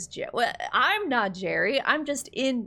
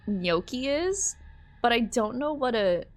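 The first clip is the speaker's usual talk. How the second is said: ordinary talk, nothing marked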